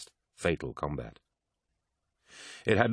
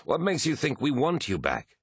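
high, disbelieving challenge; scornful demand; 8 semitones higher than usual. A narrator's reading. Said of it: "demanded Eidolon".

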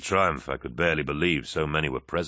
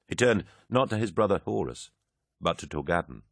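ordinary delivery

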